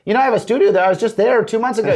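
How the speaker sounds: brightly surprised reply, lifted high; excited retelling. Retold or imitated. Telling Bulgarians he was just in Sofia, excitedly. Telling islanders about the studio.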